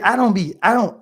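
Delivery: normal talking voice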